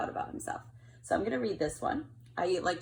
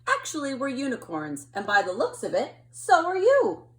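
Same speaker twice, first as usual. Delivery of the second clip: cheerful unicorn rising higher and louder at the end; 4 semitones higher, 8 dB louder than usual. Acted. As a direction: tell him the happy news, building. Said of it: "speech bubble is saying".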